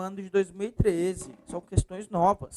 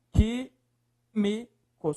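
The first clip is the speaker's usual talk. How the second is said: "Químicos" broken into slow, separate syllables; 3 semitones higher, much slower than usual. Shown as a demonstration. Teacher splitting the word for students, syllable by syllable.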